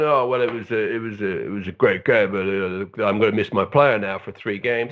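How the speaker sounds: a hint of a grumbling old manager; Roy Hodgson impression. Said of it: "Roy Hodgson ... he's going".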